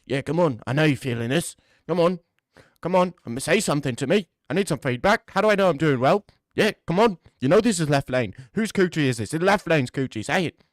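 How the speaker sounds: mock British accent, pushy and loud; hammed-up British bloke begging for feedback; British Left Lane. Left Lane boasting to a girl, mock British accent. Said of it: "What does a Left Lane sound like? He's, like, British".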